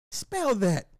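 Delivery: high-voiced mock demand; imagined sceptical wife. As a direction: play the wife challenging it, pitched high. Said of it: "imagine me telling the wife".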